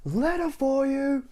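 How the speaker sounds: very high, slow yelled announcement; shouting postman. Acted. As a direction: yell it at the door. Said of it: "shouted the postman".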